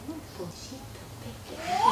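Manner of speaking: the wrong way, a weak doubled tone; diphonic voice. Shown as a demonstration. Teacher demonstrates the diphonic voice children copy. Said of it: "estoy teniendo una voz difónica".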